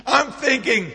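ordinary talk

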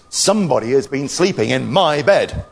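loud Father Bear growl; great big growly voice. Acted. Father Bear sees his untidy bed, growly voice. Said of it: "in his great big growly voice".

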